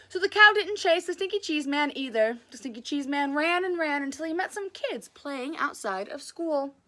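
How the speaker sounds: usual speaking voice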